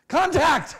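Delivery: very loud, high-pitched shout; yell. Acted. Someone halting the game over a lost contact lens, yelling. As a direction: yell it to stop a whole gym. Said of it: "And somebody yells this".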